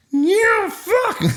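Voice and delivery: very high squealing yell through lips; comic high muffled scream; muffled shriek. Shown as a demonstration. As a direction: shriek it with the mouth shut. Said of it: "muffled scream try".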